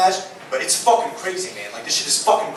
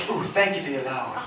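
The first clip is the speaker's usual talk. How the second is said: relieved sigh; 8 semitones lower, 9 dB quieter than usual. Retold or imitated. Roommate's mom on toilet.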